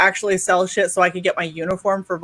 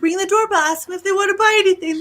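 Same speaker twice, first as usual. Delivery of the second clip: instructing; 9 semitones higher than usual. Retold or imitated. Aunt instructing niece.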